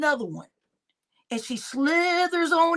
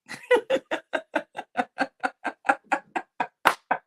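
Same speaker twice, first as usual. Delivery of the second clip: breathy laugh; 7 semitones higher than usual. Real, not acted. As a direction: laugh silently under the breath. Laugh after a self-roast.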